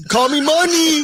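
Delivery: high, loud catchphrase yell; hype shout. Pretend. Cohost hyping a rookie autograph, loud shout. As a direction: belt it out like a hype man.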